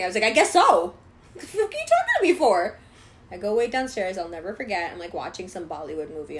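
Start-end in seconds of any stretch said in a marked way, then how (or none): 0.2-2.8 high-pitched shouted comeback; angry retort. Retold or imitated. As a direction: re-enact outraged teenage sarcasm. Retold teen argues with man.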